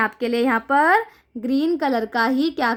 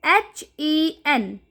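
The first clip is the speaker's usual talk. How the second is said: letters spelled out one by one, slowly; slow spelling; much slower than usual. Shown as a demonstration. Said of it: "spelling letters for child".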